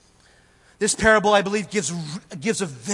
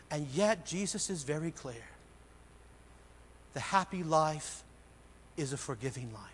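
hushed earnest preaching; 5 semitones lower, 11 dB quieter than usual. Acted. Preacher pleading quietly with the congregation, hushed and earnest. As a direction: drop to a quiet plea and let each phrase land.

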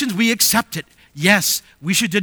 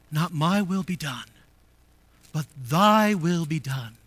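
low, softened quote of a prayer; hushed submissive prayer; 6 semitones lower, 6 dB quieter than usual. Retold or imitated. Preacher quotes Jesus praying in the garden, hushed. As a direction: lower the voice and yield gently.

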